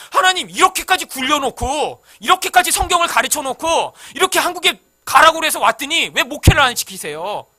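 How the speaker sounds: high, loud indignant protest; angry complaint. Retold or imitated. Pastor reenacting his complaint to God, loud indignant protest. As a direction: rail at God for the wait. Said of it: "이러고 화내다가".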